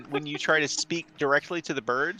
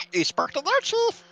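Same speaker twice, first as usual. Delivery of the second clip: high squawky bird voice; parrot voice; 6 dB louder than usual. Acted. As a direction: screech it like a parrot.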